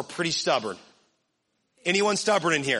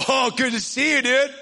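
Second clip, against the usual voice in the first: loud mock-friendly greeting; put-on sarcastic enthusiasm; 3 semitones higher, 4 dB louder than usual. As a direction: greet a buddy with exaggerated, fake delight. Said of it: "in a sarcastic way".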